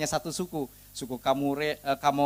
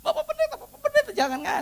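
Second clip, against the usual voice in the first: put-on high, pleading old chief. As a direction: beg the pastor in a much higher voice.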